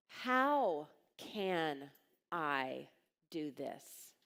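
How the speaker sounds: slow, word by word, with pauses; measured, spelled-out question; deliberate reframe. Retold or imitated. Giving the reframe question slowly.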